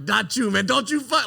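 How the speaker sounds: hammed-up movie-style shout at your buddy; Matt Damon impression. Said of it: "like Matt Damon and Good Will Hunting".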